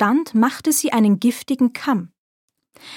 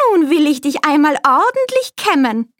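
alte Krämerin; 5 semitones higher, 3 dB louder than usual. A narrator's reading. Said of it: "sprach die Alte".

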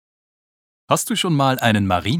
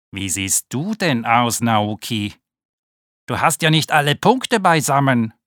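higher, teasing ladybird sibling voice; 3 semitones higher, 3 dB louder than usual. Acted. Sibling ladybird mocking Naoki about missing spots, teasing. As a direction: poke fun at the odd one out.

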